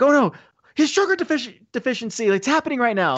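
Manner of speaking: high, panicky mock voice with a laugh breaking in; the friends panic over Jonah, in a high hammed-up panic. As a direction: ham up the alarm over a fallen friend.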